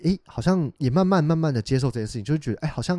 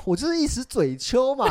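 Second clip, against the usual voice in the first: whiny excuse; 11 semitones higher than usual. Retold or imitated. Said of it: "他说".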